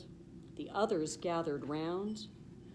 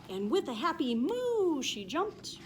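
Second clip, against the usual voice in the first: high, loud joyful lift; happy moo; 7 semitones higher, 3 dB louder than usual. A narrator's reading. Narrator cheers Cindy Moo's leap, high and loud. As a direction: sing out the joy.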